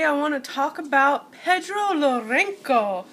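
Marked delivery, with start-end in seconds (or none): 1.3-3.0 name said the Spanish way, lightly; Spanish accent. Shown as a demonstration. Beauty vlogger names a makeup artist with a Spanish accent. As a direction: roll Pedro Lorenco out with a Spanish accent. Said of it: "I'm just saying it with a Spanish accent".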